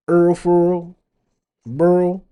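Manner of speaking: mock New Orleans accent, high and loud; butchered New Orleans drawl, loud and high. Shown as a demonstration. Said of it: "that accent everybody wanna hear".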